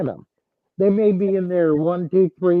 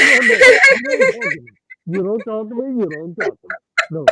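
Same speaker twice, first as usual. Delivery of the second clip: much higher, hammed-up scared squeal with laughter breaking through; squeaky mock fright, louder at the peaks; mock panic; 8 semitones higher, 4 dB louder than usual. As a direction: play a comically terrified man pointing at a hillbilly. Comic bit about spotting a hillbilly.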